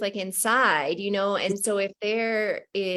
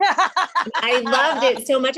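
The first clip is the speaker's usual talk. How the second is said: slow and louder, laughing through the words; laughing delight; 7 semitones higher, 7 dB louder, much slower than usual. Real, not acted. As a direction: gush about it while laughing. Laughing delight at book quote.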